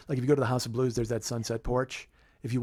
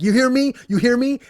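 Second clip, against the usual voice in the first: high, loud, insistent demand; 12 semitones higher, 12 dB louder than usual. Retold or imitated. Rapper gripping fan by the hair, insistent high shout. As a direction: press it home in a raised, high shout. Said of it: "he's like".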